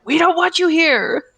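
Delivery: shouted rejection. Retold or imitated. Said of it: "people being like".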